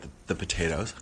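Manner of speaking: normal talking voice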